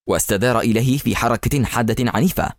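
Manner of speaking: ordinary delivery, nothing marked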